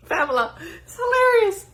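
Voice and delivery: squealing high laugh through the words. Real, not acted. Host laughing to Pamela about the fallen phone.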